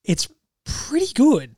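grudging praise. Retold or imitated. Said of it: "You're like".

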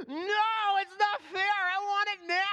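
put-on high, loud child's protest; tantrum. Son throwing a tantrum over ice cream, high loud protest. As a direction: stomp and protest like a furious five-year-old. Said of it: "he throws a tantrum".

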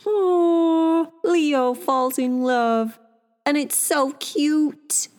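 high, drawn-out gushing coo; cooing adoration. Real, not acted. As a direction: melt over how cute it is, slowly. Gushing over the cute story.

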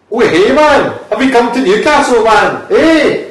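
loud, high-pitched mock Geordie shout; Geordie accent. Shown as a demonstration. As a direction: holler it like a hammed-up Newcastle local. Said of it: "I can do a Geordie accent".

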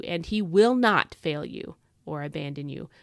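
will punched hard, the rest read evenly; stress on will. Shown as a demonstration. Reading the verse aloud to listeners, will hit hard. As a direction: lean into the word will, then let the verse finish. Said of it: "emphasize that word will".

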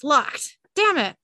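loud, rising angry curse; frustrated shout. Acted. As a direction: bark the curse out. Door slams shut, frustrated.

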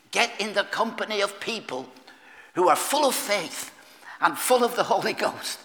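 ordinary talk, nothing marked

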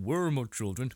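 imitating Agatha's slipping accent, a wavering put-on accent; mock wavering accent. A host mimicking Agatha's accent for his co-host, a wavering accent. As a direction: lean into an accent that comes and goes. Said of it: "that accent really comes and goes".

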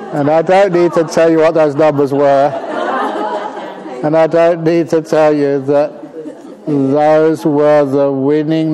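laughing. Real, not acted. Monk tells listeners about winning lottery numbers, laughing loudly. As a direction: deliver the punchline still laughing.

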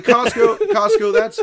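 high, loud singsong dismissal; mock dismissive. A streamer mockingly brushing off a fake Costco account, in a loud, high singsong. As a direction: call the brand's name high and loud, brushing it off.

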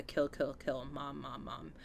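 whisper-quiet, fading chant; hushed horror refrain. Retold or imitated. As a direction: breathe it out like the film score. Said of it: "refrain that Harry Manfredini made".

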